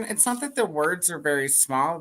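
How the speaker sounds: ordinary delivery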